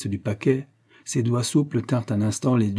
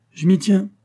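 higher, louder triumphant cry; exclaiming; 9 semitones higher, 5 dB louder than usual. A narrator's reading. Ralph exclaims at the card table, higher and louder. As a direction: shout it out as the winning call. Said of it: "s'écria Ralph".

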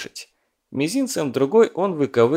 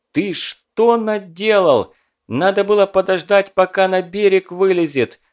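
angry scolding; 9 semitones higher, 5 dB louder than usual. A narrator's reading. Someone scolds after explosion.

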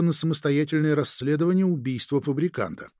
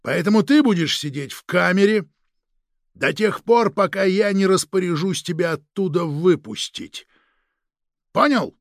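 raised, higher voice, insistent to the last word; stern order; 5 semitones higher, 4 dB louder than usual. Acted. Chief orders agent held in a cell, stern and loud. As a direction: lay down the law forcefully.

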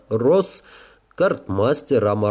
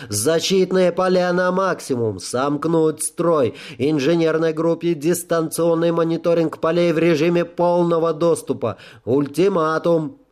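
higher barked commands; gruff barked parade orders; 6 semitones higher than usual. A narrator's reading. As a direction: bark orders under fire. Commander orders shields maximum.

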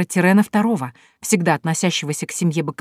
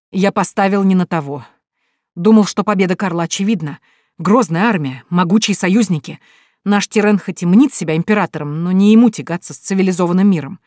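ordinary delivery, nothing marked

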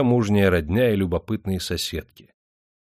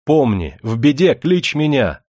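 calling after her; 6 semitones higher, 6 dB louder than usual. Acted. Perstень calls after departing Katyusha, loud and high.

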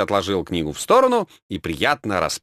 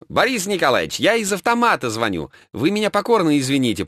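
moaning caller; 8 semitones higher than usual. A narrator's reading. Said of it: "простонал голос в трубке".

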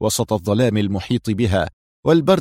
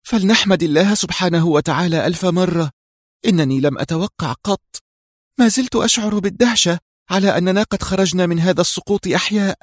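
higher-pitched voice for the woman; woman's amazed relief; 8 semitones higher than usual. A narrator's reading. Reham to her companions after surviving, amazed relief. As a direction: voice her wonder at being alive, pitched up. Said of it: "زفرت ريهام قائلة".

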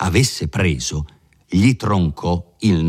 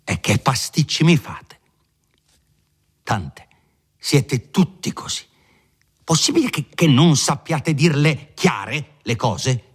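higher, impatient scolding; exasperated lawyer; 8 semitones higher than usual. Acted. Lawyer scolding Renzo, higher and exasperated. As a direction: throw up your hands at him.

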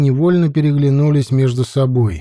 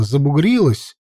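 ordinary delivery, nothing marked

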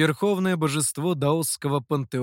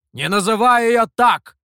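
loud, high furious shout; angry shout; 7 semitones higher, 10 dB louder than usual. A narrator's reading. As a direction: bark it at him, looming over. Demon looms over boy.

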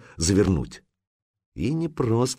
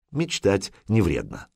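usual speaking voice

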